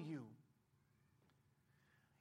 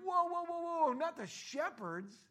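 alarmed objection; 6 semitones higher, 4 dB louder, slower than usual. Retold or imitated. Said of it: "And they're like".

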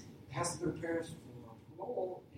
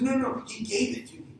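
insistent protest; 8 semitones higher, 9 dB louder than usual. Acted. Thief lying to police.

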